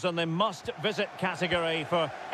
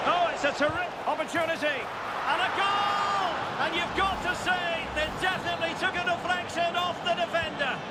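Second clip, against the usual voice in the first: excited goal call; 10 semitones higher than usual. Acted. Commentator calls the equaliser for the crowd, high and thrilled. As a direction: race up through the chance, then stretch out the goal.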